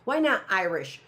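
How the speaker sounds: usual speaking voice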